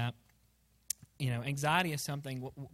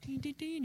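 mock chime; 11 semitones higher, 7 dB quieter than usual. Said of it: "the soft string music".

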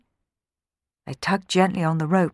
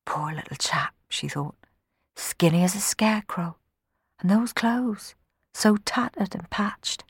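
whispered thought; 4 semitones higher, 4 dB quieter than usual. Acted. Maid's pitying private thought.

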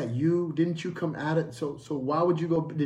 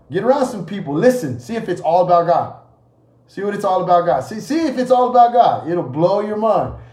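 raised, forceful preaching; loud preaching challenge; 2 semitones higher, 8 dB louder than usual. Real, not acted. Preacher daring congregation to listen, loud and forceful. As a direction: drive the challenge out to the whole room.

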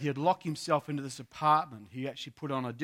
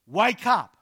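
loud, high cross command; raised authoritarian voice; 6 semitones higher, 9 dB louder than usual. Retold or imitated. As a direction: call it out sternly, like a rebuke. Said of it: "It sounds a bit cross with him".

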